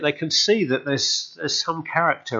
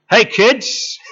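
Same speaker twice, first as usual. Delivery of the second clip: higher, louder cheery shout across the water; hearty hail to the boat; friendly call; 10 semitones higher, 8 dB louder than usual. Retold or imitated. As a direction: call out like greeting old friends from shore. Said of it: "Jesus says".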